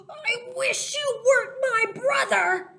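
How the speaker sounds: high, loud frustrated shout. Acted. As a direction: yell it like a furious big sister. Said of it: "even want to shout".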